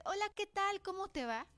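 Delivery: high, lilting, amiable hello; friendly greeting. Shown as a demonstration. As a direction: greet them like a kind, welcoming person. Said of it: "pensarías que soy una persona amable".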